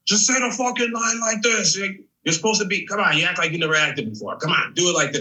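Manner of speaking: hammed-up bossy yelling, playful; mock yelling director. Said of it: "he's yelling at them".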